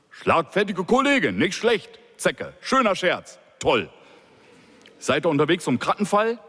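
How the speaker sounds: an irritated police chief mocking the quip; gruff boss, mocking. A narrator's reading. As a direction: toss the words back with dry sarcasm. Boss repeats the words mockingly.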